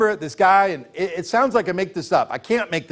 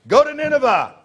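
God's command; 7 semitones higher than usual. Acted. God commanding Jonah.

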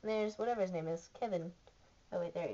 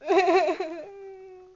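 loud, high burst of laughter; helpless high laugh trailing off; laughing fit; 10 semitones higher, 7 dB louder than usual. Real, not acted. Laughing until tears come.

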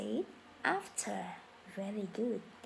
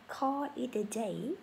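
slow, careful teacher model of the idiom; slow model phrase; slower than usual. Shown as a demonstration. Teacher modelling for students, slow and clear. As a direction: say it slowly for students to repeat. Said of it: "Mình sẽ nói là".

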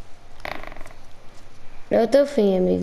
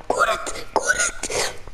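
thin, very high, softer squeak; desperate squeal; 6 dB quieter, slower than usual. Real, not acted. Desperate to heal in game.

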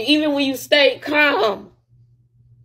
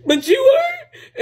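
laugh-crying jab; 11 semitones higher, slower than usual. Real, not acted. Jabbing back at partner.

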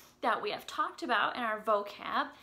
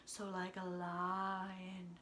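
lower, slow, softer lion-like voice; 5 semitones lower, 11 dB quieter, much slower than usual. Shown as a demonstration. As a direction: drop down low like a lion. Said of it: "sounds that are low".

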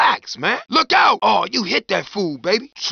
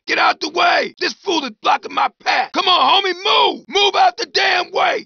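angry yelling; 7 semitones higher than usual. Acted. Character yelling at a man blocking his path, angry shout. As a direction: bark him out of your path.

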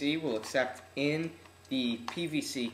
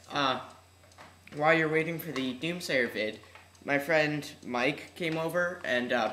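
ordinary delivery

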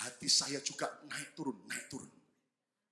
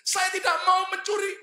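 tearful church member; 14 semitones higher, 8 dB louder than usual. Retold or imitated. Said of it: "Dia nangis".

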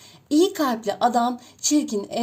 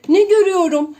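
loud, higher, dismayed mother duck; upset outcry; 4 semitones higher, 7 dB louder, faster than usual. A narrator's reading. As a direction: protest loudly at the odd duckling. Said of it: "diye haykırır". (anne ördek telaşla yüksek sesle)

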